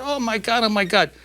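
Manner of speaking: high-pitched mock wail of grief; mock crying. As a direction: imitate weeping widows, lightly hammed up. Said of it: "they're crying their eyes out".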